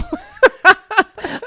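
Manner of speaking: high burst of laughter; real shriek of laughter; loud laugh. Real, not acted. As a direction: crack up loudly. Big laugh at dust bunny story.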